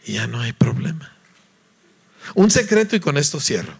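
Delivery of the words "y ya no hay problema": whispered reassurance. Real, not acted. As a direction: let it drop to a breathy whisper. Quiet resolution, unvoiced.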